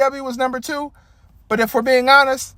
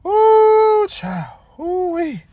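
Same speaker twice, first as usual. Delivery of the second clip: scandalised ooh; 10 semitones higher, much slower than usual. Real, not acted. Gasps at the tag team's outfits.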